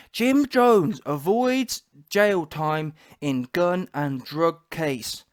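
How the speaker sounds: very slow, drawn-out headline reading. Acted. Host presenting proof to viewers, slow headline read. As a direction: announce it word by word.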